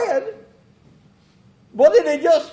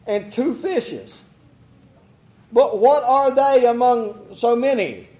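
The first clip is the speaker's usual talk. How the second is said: scripture reading; 5 semitones lower than usual. Acted. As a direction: read the passage aloud like from the pulpit. Preacher reads scripture aloud.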